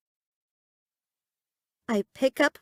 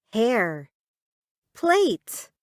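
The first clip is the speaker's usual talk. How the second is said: plain reading voice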